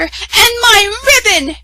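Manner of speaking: high and loud outrage; grabbing the sash; angry cry. A narrator's reading. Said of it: "cried the other".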